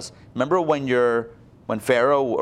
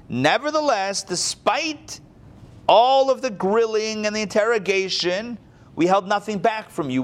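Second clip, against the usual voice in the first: higher, earnest verse reading; scripture read with raised pitch; dramatic scripture reading; 7 semitones higher than usual. Acted. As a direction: read it as Judah's defence.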